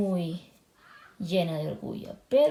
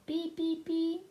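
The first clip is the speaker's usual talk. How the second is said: high beep beep; a higher playful horn call; 7 semitones higher, 3 dB quieter than usual. Acted. Horn sound in story.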